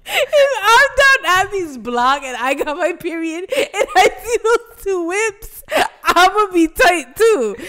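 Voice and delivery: high, loud, drawn-out rant; loud mock outrage. Friend siding with a neighbour parking complaint, loud mock outrage. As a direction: shout it with playful indignation.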